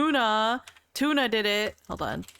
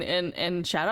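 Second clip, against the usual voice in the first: ordinary talk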